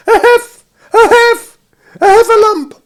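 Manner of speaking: high, loud gasped bursts; Piglet out of breath. A narrator's reading. Piglet panting to Christopher Robin, high breathless stammer. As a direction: squeak it in broken, breathless bursts. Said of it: "breathing so hard he could hardly speak".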